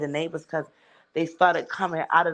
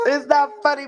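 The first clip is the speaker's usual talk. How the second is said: real laughter breaking through the words; laughing; 8 semitones higher, 4 dB louder than usual. Real, not acted. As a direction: let the laugh spill over the line. Laughs retelling sister's episode.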